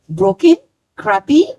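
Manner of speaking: usual speaking voice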